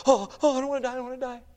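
mock fearful. Mimics believer threatened with death.

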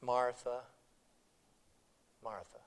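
soft, low, tender calling of her name; loving rebuke. Retold or imitated. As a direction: gently call her back. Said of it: "the Lord's loving rebuke".

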